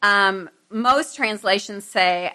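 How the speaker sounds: usual speaking voice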